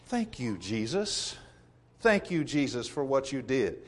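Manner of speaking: soft, low, prayerful thanks; quiet gratitude. Acted. Voicing a worshipper's thanks to Jesus.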